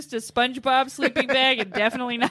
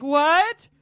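a bit louder, higher baffled "What? What?"; clueless rich tourists; 4 semitones higher, 6 dB louder than usual. Retold or imitated. Narrator mocks stranded wealthy festivalgoers, with bewildered cries. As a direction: play a lost rich tourist throwing up his hands.

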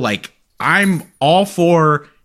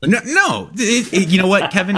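much higher-pitched, stammered denial; protesting; 8 semitones higher than usual. Real, not acted. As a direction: hurry to deny the teasing accusation. Protesting the co-host's teasing suspicion.

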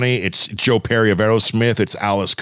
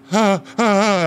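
high panicked little ah ah ah gasps; mock freaking out; 9 semitones higher, slower than usual. Host imitating his girlfriend freaking out. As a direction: imitate a fan losing it. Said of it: "she's, like, freaking out".

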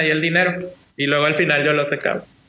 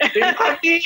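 high-pitched mock-frantic cry; retold urgent call; 11 semitones higher, slower than usual. Son runs to tell his mum, urgent.

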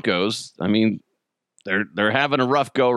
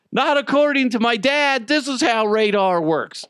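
put-on proud kid; 9 semitones higher than usual. Son correcting his teacher.

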